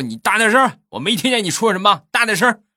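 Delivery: loud, high-pitched barked order; demanding shout. Retold or imitated. Uncle urging mumbling nephew, high demanding shout. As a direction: yell at him to speak up.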